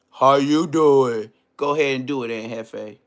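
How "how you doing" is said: slow, loud drawn-out talk show greeting; Wendy Williams impression. Mocking a guest by doing Wendy Williams' greeting, slow and loud. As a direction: stretch it like a daytime host. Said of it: "Wendy Williams?".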